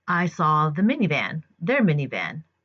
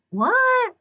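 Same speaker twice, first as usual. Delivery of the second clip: very high-pitched mock disbelief; baffled what; 4 dB louder than usual. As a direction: imitate a neighbor taken totally aback. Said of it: "he looks at me like".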